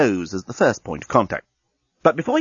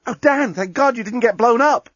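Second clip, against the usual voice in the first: relieved woman's voice; 12 semitones higher, 3 dB louder than usual. Acted.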